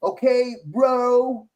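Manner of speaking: patronizing chill-out-bro imitation; mock a cop getting chummy, pitched high; mock chummy dude-speak.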